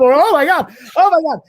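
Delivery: high, loud frantic cry; hammed-up hysterical alarm; mock panic. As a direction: wail it out in escalating panic. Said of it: "I start panicking".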